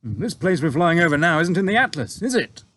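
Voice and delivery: a touch of a smug grin; grinning pilot. A narrator's reading. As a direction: pilot teasing his boss, grinning.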